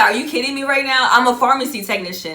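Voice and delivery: loud, fast, incredulous retelling; mock outrage at the coincidence; retold disbelief. Telling the story of meeting the stranger, incredulous and fast.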